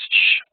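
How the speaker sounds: "sh" as a strong, voiceless hush; sibilant sh. Shown as a demonstration. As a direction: push out a bare "sh" hiss. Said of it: "S H in shy".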